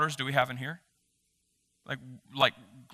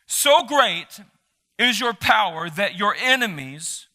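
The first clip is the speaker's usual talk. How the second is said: slow, louder and higher proclamation; enthusiastic scripture reading; 5 semitones higher, 5 dB louder, slower than usual. Acted. Pastor reading Psalm sixty-six to the church, fervent proclaiming. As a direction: preach the psalm like a call to praise.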